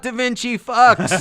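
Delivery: slow, high, loud callout; mock gang callout. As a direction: call them out like a gang. Calling out a gang.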